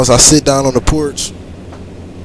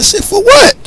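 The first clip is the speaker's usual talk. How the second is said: high, loud indignant what; outraged squeak at the threat of jail; indignant disbelief; 6 dB louder than usual. Retold or imitated. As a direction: shoot up in disbelief. Indignant reply to cop.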